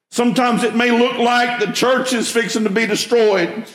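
rising sermon voice, building intensity; preaching. Acted. Pastor preaching Isaiah to his church, earnest sermon voice. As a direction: preach it to the congregation.

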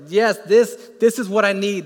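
loud, lifted, eager; excited yes. Retold or imitated. Worshipper as the songs come on, loud eager yes. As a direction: cry out that this is what you need. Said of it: "you're just like".

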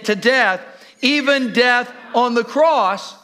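loud, high, building scripture reading; preaching at full force. Acted. Pastor booming scripture to the congregation, rising preach. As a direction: proclaim it to the whole church.

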